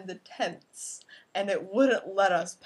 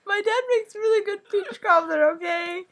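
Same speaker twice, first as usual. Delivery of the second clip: emphatic, near tears; 9 semitones higher, 6 dB louder than usual. Real, not acted. Defending dad's cobbler emphatically.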